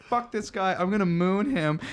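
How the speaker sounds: put-on defiant drunk girl. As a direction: announce the moon defiantly.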